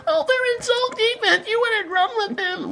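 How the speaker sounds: very high, squeaky whining mock voice; mocking commenter. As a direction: whine it like a clueless commenter in falsetto. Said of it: "that shit gets me so irritated".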